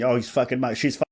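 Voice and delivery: usual speaking voice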